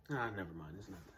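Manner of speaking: low, soft dismissal under the breath; quiet backtracking. Acted. Teen monologue character takes back a confession, quiet and dismissive. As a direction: drop away sharply in pitch and volume.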